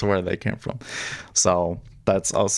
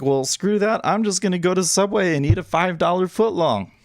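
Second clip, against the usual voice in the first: self-quote; 10 semitones higher than usual. Retold or imitated. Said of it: "And I was like".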